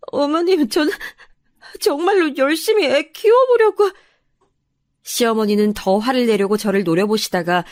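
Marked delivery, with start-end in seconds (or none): 0.1-3.9 high, sobbing daughter-in-law; acted crying, voice climbing; tearful pleading. A narrator's reading. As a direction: choke out an explanation through tears. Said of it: "서러워져서 눈물이 쏟아져 나왔죠".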